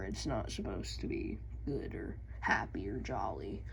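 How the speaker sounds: low, soft gloomy whisper. Real, not acted. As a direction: whisper it like grim lore. Mock gloomy lore remark.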